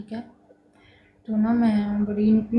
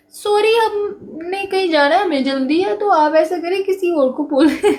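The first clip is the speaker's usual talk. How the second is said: higher, louder excuse with a laugh breaking in; mocking the men again; 8 semitones higher, 4 dB louder than usual. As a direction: brush her off, then laugh at it. Men refuse again, mocked.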